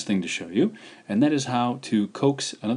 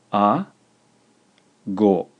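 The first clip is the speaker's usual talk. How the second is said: A and go split by a long pause; measured dictation, syllables held apart; slow, syllable by syllable; 4 dB louder than usual. Shown as a demonstration. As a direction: dictate A, then go, each syllable alone. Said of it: "slowly a couple times".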